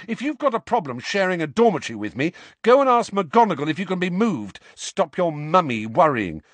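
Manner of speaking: fast, high, loud angry rant; rising temper. A narrator's reading. Harry losing his temper at Seamus, fast and loud. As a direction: let the temper boil over. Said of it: "his temper rising so fast".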